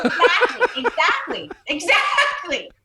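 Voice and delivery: laughing as she agrees; laughing agreement. Real, not acted. Agreeing with her friend while laughing.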